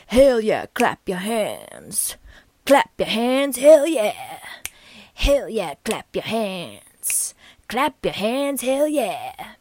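swooping, drawn-out jazzy croon; showy jazz vocalist, stretched vowels; jazz singer. Acted. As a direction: scat it out like a jazz singer, sliding up and down. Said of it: "the phantom voice of the V-Birth is a jazz singer".

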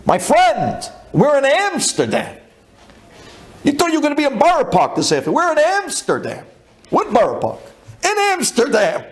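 high-pitched, impassioned exclaiming; fervent amazement. Retold or imitated. Leader to friend far from Borough Park, fervent amazement. Acted pep talk, amazed at being abroad.

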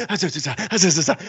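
high, mock crowd babble of "zah, zah"; imitating crowd frenzy. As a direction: imitate a theater going wild. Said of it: "Everyone's like".

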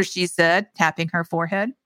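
ordinary delivery

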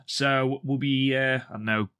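normal talking voice

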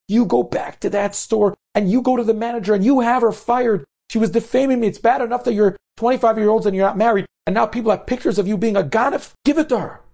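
high, insistent outburst; acted indignant mother; upset mother's tirade. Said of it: "And her mother's crying".

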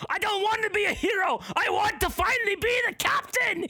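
high, fast, shouted frustration; skipper outburst. Pretend. Skipper rages that he wants to be captain, shouting high. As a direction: rant like the eternal second mate.

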